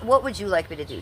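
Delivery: ordinary talk, nothing marked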